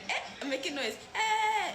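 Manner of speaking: high, laughing happy exclaim; forced cheerful. Shown as a demonstration. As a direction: chirp it out cheerfully. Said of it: "but you're happy".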